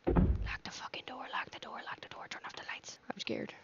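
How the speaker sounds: urgent scared whisper; panicked hushed whisper; frightened whisper. Real, not acted. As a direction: whisper it hiding from an intruder. Hiding from intruder, whispering.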